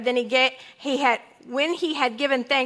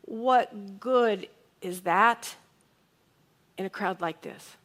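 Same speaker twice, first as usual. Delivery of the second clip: slow, wondering reading; 3 semitones lower, slower than usual. Shown as a demonstration. Preacher offers congregation another reading of Andrew's question, slow and wondering. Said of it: "what if he said it this way?".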